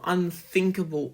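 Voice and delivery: ordinary delivery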